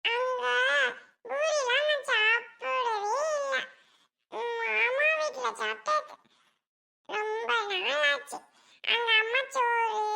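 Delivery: put-on high, slow little child voice; a tiny child slowly listing things, word by word; plaintive small child. Child telling uncle about food at home, plaintive small child. Child character tells of poor meals.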